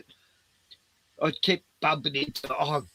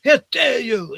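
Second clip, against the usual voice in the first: high, loud mock outrage; indignant staff scolding; 10 semitones higher, 9 dB louder than usual. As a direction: scold like an offended shop worker. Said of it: "I've been told off by staff".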